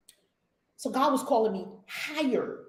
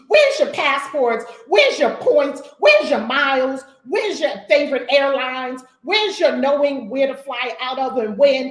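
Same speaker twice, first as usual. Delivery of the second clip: scolding; 8 dB louder than usual. Real, not acted. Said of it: "scolding her travel community".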